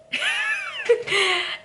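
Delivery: high shrieking burst of laughter. Real, not acted. Vlogger recalling the knife pose, shrieking laugh.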